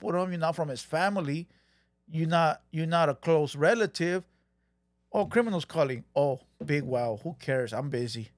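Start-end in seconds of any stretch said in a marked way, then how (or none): 5.1-8.3 low, bored brush-off; lazy low mock indifference; dismissive mimicry. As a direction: shrug off the call like it's nothing. Imitating Rob brushing off call.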